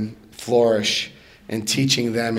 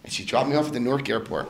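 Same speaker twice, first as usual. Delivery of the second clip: usual speaking voice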